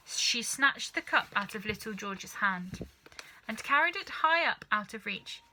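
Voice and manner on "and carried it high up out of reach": usual speaking voice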